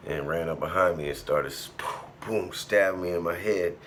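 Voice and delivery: normal talking voice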